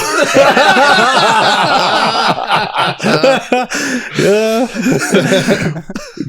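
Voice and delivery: long high giggling laugh. Real, not acted. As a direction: laugh it out fully. Laughs at own story.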